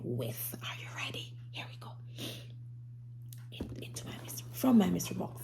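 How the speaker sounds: breathless hush before a surprise; whisper. Acted. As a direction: whisper like sharing a secret. Teacher whispering before the reveal.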